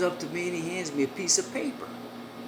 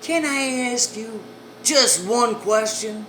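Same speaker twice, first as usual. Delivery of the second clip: madam's slow question; 6 semitones higher, 5 dB louder, slower than usual. Retold or imitated. Madam asks him slowly.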